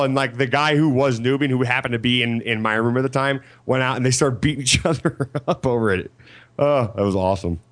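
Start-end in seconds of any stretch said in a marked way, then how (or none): none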